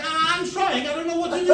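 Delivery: high, loud, flustered protest; panicked. Retold or imitated. Player running out of time at the keypad, panicked. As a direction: let panic push the voice up.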